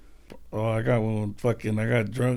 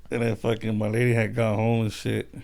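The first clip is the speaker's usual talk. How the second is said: normal talking voice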